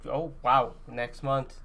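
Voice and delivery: ordinary talk, nothing marked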